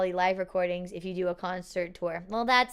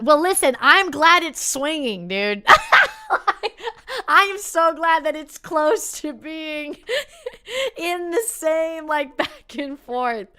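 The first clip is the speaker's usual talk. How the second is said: laughing loudly, higher, through the words; 6 semitones higher, 7 dB louder, slower than usual. Real, not acted.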